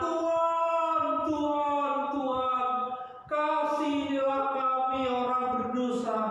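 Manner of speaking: high, loud cries of Tuhan fading down into a half-sung plea; wailing plea. Acted. The weeping city crying to God for mercy, high wail.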